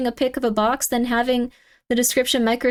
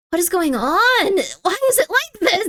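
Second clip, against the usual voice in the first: high, far-from-usual exasperated cry; exasperated outburst; 7 semitones higher than usual. Real, not acted. Streamer losing it over confusing listings, exasperated. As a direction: demand answers in rising disbelief.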